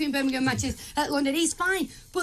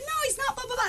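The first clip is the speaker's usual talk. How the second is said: mock the guest, high and dismissive; squeaky dismissive imitation; 11 semitones higher, faster than usual. Mocking guest's objection.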